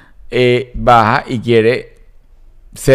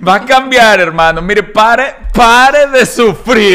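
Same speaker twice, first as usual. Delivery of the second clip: mock preacher; 9 semitones higher, 4 dB louder, slower than usual. Parodying a televangelist slogan.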